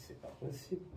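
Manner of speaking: breathless whispered disbelief; hushed can't-be-true whisper; stunned whisper. Retold or imitated. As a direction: barely voice it, staring at the sky. Gazing up in disbelief.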